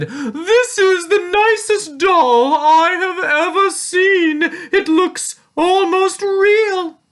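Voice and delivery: very high, loud, slow voice; gushing store lady. A narrator's reading. Store lady mistakes Tia for a doll, gushing. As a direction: gush in a piping, stretched-out voice.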